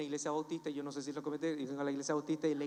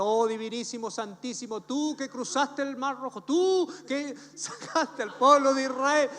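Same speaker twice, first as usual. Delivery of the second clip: slow, louder, pompous churchy prayer, rising to the end; mock Baptist prayer; 6 semitones higher, 7 dB louder, slower than usual. Young man praying in Baptist style before the prayer circle, hammed-up solemn. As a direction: ham up a stiff memorized Baptist prayer, dragging every phrase. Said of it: "modo bautista".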